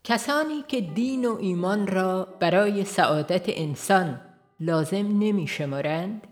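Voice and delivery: usual speaking voice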